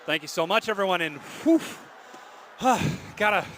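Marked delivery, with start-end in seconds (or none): 2.6-3.1 relieved exhale. Real, not acted. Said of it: "catch my breath after that one".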